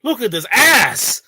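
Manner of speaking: slow, loud, high leering exclamation; loud ogling. Real, not acted. Co-host ogling the woman on screen, loud slow leer. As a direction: drag out each word with loud glee.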